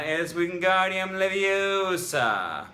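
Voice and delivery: high, loud, slow and bossy, long o in "Leviosa"; prim correction. Retold or imitated. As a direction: lecture it slowly like a prim classmate, "LeviOsa". Quoting the Harry Potter spell lesson.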